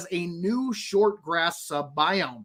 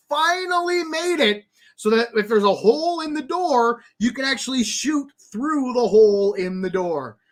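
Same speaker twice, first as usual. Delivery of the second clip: high, excited burst of relief; relieved excitement rising high; 5 semitones higher, 4 dB louder than usual. Real, not acted. Excited about shootable holes.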